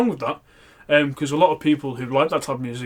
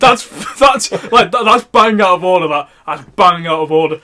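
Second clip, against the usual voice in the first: mock indignation; 7 dB louder than usual. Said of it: "And I'm like".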